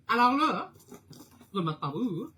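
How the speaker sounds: excited build-up. Real, not acted. Painter to her live viewers before starting, excited build-up. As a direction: hype up the big moment.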